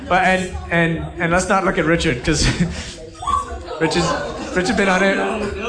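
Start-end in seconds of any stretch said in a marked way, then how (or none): 2.5-3.8 breathy high giggle; high wheezing giggle. Real, not acted. Laughing at Richard.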